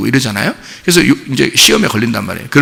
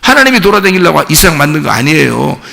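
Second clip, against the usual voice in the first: ordinary talk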